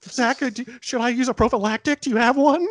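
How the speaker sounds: a desperate nerd begging his friend; mock-frantic high-pitched geek; panicky Screech. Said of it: "go ask Zach".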